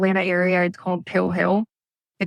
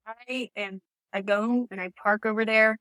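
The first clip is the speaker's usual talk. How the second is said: normal talking voice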